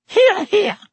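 very high, loud little squeak; squeaky. A narrator's reading. Tiny professor agrees with his colleague, squeaked. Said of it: "squeaked tiny Professor Flitwick".

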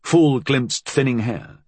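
usual speaking voice

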